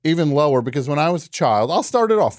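normal talking voice

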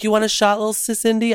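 put-on high-pitched teasing offer to a kid; joking offer. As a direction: coax a little kid in jest, high. Joke offer to a child.

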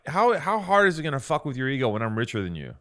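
ordinary talk

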